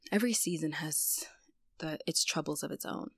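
sighed whisper. Real, not acted. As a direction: breathe it out, resigned. Sighing reflection on hard times.

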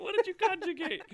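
high, loud, helpless with laughter; laughing bewilderment. Real, not acted. Baffled learner facing Arabic word endings, laughing bewilderment. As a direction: squeal it out while cracking up.

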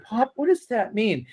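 put-on high, curious child asking Pop; little boy asking. Little boy in the car asking Pop, curious. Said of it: "And, and I go".

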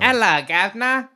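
high, cheeky Cockney greeting; mock Cockney accent. Shown as a demonstration. Friend showing off a disguise, mock Cockney accent. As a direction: put on a comic Cockney voice.

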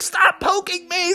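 high, soft, laughing plea; a cub half-laughing while pecked; giggly whiny cub. Acted. Bubby begs the woodpecker, half laughing.

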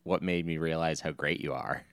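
usual speaking voice

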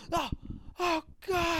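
high wailing cry of pain; mock anguished cry. Retelling the werewolf transformation, mock pained cry. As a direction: cry out like the man turning into a wolf.

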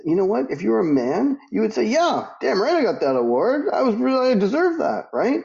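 retold rant to self-doubting women, high and forceful; fired-up retort. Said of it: "I said".

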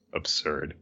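a soft unvoiced whisper; hushed whispered confession. Real, not acted. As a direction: whisper it like a guilty secret. Confession after the absurd chapter.

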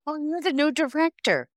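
very high imitated proclamation; acted cheery announcement. Recruiter informing the unsuspecting student she is director, high acted cheer.

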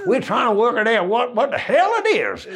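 high, puzzled mock Southern bandsman; Southern US accent. Band trying to work out comedian's speech, high puzzled Southern American accent. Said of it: "so the accents have always been".

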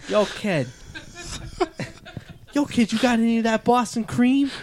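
put-on a Boston guy calling to a kid, cracking up; giggly put-on Boston accent. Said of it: "the best Boston accent you're gonna get".

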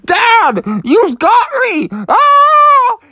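very high, loud alarmed cry; panicked Froggy. Acted. Froggy hooked to dad, very high alarmed cry. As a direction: wail that dad caught him. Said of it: "And he's like".

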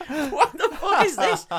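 high, incredulous squeal through laughter; laughing disbelief. Retold or imitated. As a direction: crack up in mock bafflement. Baffled reaction to the scene, laughing.

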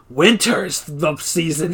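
slow, dopey Kevin drawl. Retold or imitated. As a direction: drag it out like Kevin. Kevin interrupting the teleconference.

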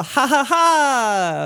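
slow, drawn-out fake laugh; mock triumphant laugh. Hosts gloating over other people's mistakes, slow mock laughter. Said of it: "And we go".

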